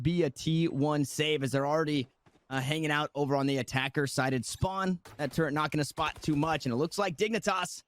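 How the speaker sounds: quick live play-by-play call; esports caster. Acted. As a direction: call the round as it unfolds.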